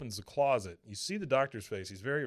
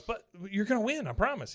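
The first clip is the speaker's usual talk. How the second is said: higher Peggy voice; 10 semitones higher than usual. Retold or imitated. Peggy voiced in retold scene.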